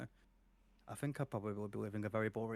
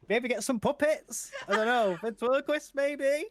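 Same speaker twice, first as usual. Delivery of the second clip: laughing; 7 dB louder than usual. Real, not acted. Laughing at voice-acting joke.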